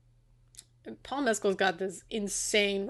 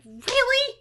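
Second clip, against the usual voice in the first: much higher, sarcastic "really?"; exasperated disbelief; 3 dB louder than usual. Real, not acted. Mocking the fragile hero plot to co-host, high sarcastic disbelief. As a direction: scoff at the plot in a high incredulous voice. Ranting about the movie's ending.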